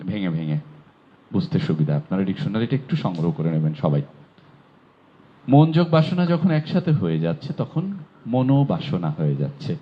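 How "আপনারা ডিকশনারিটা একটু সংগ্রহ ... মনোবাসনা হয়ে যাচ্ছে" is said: ordinary talk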